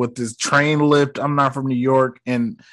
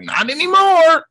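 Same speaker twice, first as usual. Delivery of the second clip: much higher put-on voice; mock retort; 13 semitones higher than usual. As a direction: imitate a squealed comeback. Retelling dad's reversal in film.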